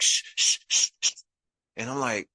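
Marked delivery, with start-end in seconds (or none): 0.0-1.1 repeated unvoiced shushes; retold secretive hush. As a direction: urgently shush an excited child. Sister hushing the excited child.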